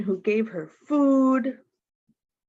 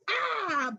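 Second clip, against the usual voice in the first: mock cry of dismay; 12 semitones higher, 4 dB quieter than usual. Hair sticking up in mirror.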